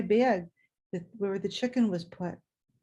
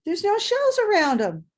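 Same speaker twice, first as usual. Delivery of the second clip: much higher and louder protest; indignant child; 11 semitones higher, 6 dB louder than usual. Retold or imitated. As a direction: object like a puzzled little girl. Child objecting about eggs.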